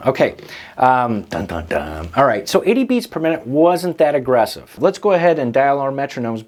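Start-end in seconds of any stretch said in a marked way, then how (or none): 1.2-2.1 low, soft "dun dun dun"; mock suspense sting. Teacher announcing test time to students, mock suspense sting. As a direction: voice a playful drumroll of doom, dropped low and hushed.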